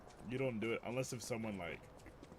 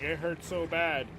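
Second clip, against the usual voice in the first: higher, drawn-out mock whine of pain; imitated crying; 6 semitones higher, 4 dB louder, slower than usual. Hurt cousin after the firework, imitated crying. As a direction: imitate a hurt kid wailing, half-hearted. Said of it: "he was crying".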